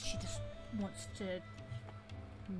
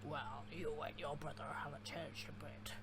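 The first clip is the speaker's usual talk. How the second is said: lightly mimicked slow, lower voice; mimicking a game character; 4 semitones lower, 4 dB quieter, slower than usual. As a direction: echo his drawn-out line, slow and low. Echoes the game character's line back.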